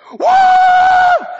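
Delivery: very high loud whoa; imitating Andy's whoa. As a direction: belt it like an overjoyed friend. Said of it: "Andy will walk in and be like".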